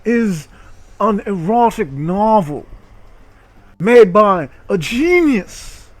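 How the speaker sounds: hammed-up dramatic announcement of the erotic novel; mock grand announcement. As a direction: present it like a ringmaster, tongue in cheek.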